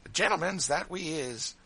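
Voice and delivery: high, loud teasing; mocking gentility. A narrator's reading. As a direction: jeer at someone's airs. Sambo mocks Adolph's gentility.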